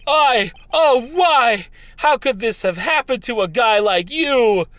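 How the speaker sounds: hammed-up grief. Hammed grief over the body.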